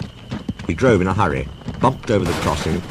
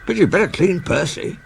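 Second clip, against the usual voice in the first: high signalman; 12 semitones higher than usual. Acted. Signalman teasing Percy.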